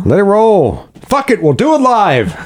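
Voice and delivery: high-pitched, hammed-up yell; mock battle cry. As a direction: holler it like a fired-up rallying cry. Mock rallying cry, quoting a catchphrase.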